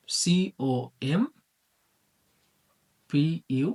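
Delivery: spaced-out syllables with long pauses; broken into syllables. Shown as a demonstration. Teacher breaking a word up for students, syllable by syllable. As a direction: say COM, পিইউটিইআর very slowly in separate chunks. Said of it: "এখন যদি আমরা এটাকে ভাঙি".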